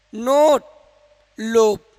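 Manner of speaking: each word called out loud for the class; loud drilled words. Shown as a demonstration. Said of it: "नोट नहीं".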